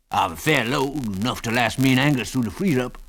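put-on wheezy old prospector voice; wheezy old man. A narrator's reading. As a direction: rasp it out like a wheezy old miner. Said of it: "in a wheezy voice".

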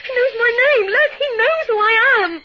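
high, loud panicked sobbing; crying out in terror; terrified crying. Acted. Anonymous caller knows her name.

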